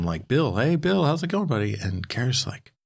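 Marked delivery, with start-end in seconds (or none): 0.3-1.7 higher, chummy hail across the bar; lightly voiced friendly bartender; chummy greeting. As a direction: greet him like an old pal. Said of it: "Norman starts calling him".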